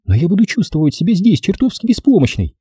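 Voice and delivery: high-pitched female complaint; fretful woman. Acted. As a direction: fret in a high lady's voice. Лайза frets about staying behind.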